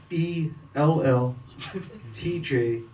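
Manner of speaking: normal talking voice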